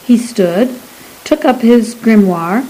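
ordinary delivery

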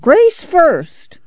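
put-on high, insistent old-woman demand; clamor for it shrilly; clamoring grandma. A narrator's reading. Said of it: "Grandma clamored".

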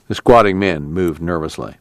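plain reading voice

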